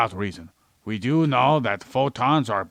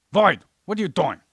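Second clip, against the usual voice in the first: much higher, questioning call; calling out sharply; 7 semitones higher than usual. Acted. Host calling Void who wandered off, sharply questioning. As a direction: catch a distracted partner's attention. Calling the distracted cohost back.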